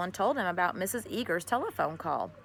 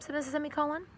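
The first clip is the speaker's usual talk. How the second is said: ordinary delivery, nothing marked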